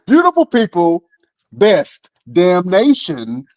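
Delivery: high, drawn-out announcer call; show sign-off. Pretend. Host hailing the podcast audience, high announcer sign-off.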